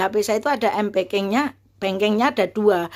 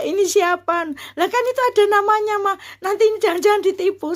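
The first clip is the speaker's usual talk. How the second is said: high, jittery mock alarm; retold fear; 12 semitones higher than usual. Mother and child over an incoming call, fearful of being scammed. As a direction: re-enact jumpy suspicion in a raised pitch. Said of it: "saking takutnya saya gitu ya".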